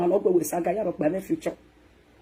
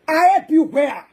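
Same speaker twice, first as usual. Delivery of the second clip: higher, louder I be where; loud outraged question; 7 semitones higher, 6 dB louder, much slower than usual. Acted. To the crowd, loud indignant repeat. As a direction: hit it high and loud.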